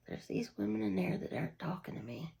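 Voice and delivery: hushed whisper to Dad. Retold or imitated. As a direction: whisper it, barely voiced.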